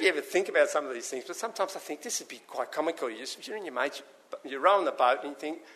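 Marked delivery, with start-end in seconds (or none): none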